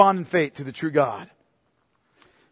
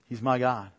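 quiet confession; 7 semitones lower, 9 dB quieter than usual. Acted. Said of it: "the confession of Paul's soul".

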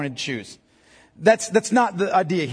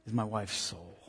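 tender and hushed; 12 semitones lower, 15 dB quieter than usual. Real, not acted. Tender confession about his wife.

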